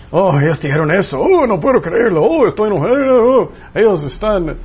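mock outrage, wailing up high; offended complaint. The flesh refusing to deny itself, hammed-up indignation. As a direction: ham up an indignant moan.

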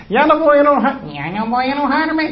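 put-on high, sing-song baby promise; mock baby voice. Mimicking a baby to the church, high baby voice. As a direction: play a tiny baby swearing off anger.

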